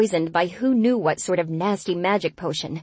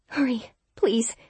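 pleading; 6 semitones higher, slower than usual. Acted. Pleading for help to come.

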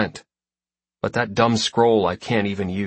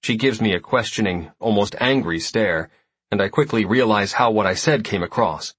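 ordinary delivery

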